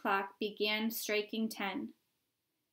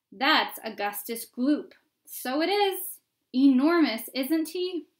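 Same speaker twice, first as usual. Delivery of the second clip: crowd onlooker; 4 semitones higher, 9 dB louder than usual. Acted.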